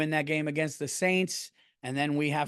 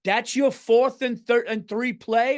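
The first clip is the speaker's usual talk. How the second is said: much higher, louder and slow, hammed-up proud dad; imitating Tommy Cutlets' dad; 8 semitones higher, 6 dB louder, slower than usual. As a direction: belt it like a thrilled dad in the stands. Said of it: "When his dad went".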